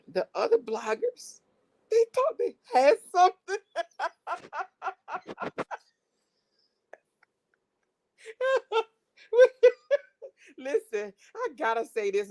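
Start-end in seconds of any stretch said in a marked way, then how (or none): 1.9-8.8 collapse laughing as you finish; helpless laughter. Real, not acted. Cracking up about other bloggers.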